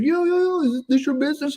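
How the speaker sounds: retelling a man hailing his van, high mock voice; imitating a stranger. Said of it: "He's like".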